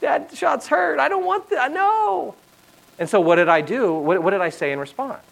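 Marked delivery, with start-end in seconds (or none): 0.0-2.3 put-on little girl pleading. Dad imitating his daughter refusing the shot, whiny child voice. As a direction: plead like a scared five-year-old. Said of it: "her first response is".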